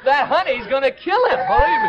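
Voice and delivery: laughing loud and high. Real, not acted. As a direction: laugh through the words with delight. Host laughing at contestant.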